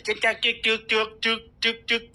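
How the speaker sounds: rapid staccato "juek-juek" sound effect; imitated chopping noise. Shown as a demonstration. Host shows viewers numbers being cut, imitated chopping noise. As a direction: tick off each cut with a short clicking syllable.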